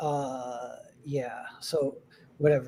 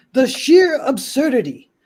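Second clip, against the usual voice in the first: slow, louder and higher, appalled; scoffing indignation; 9 semitones higher, 7 dB louder than usual. Acted. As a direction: sound scandalized at everything. Guest scoffs at the hotel.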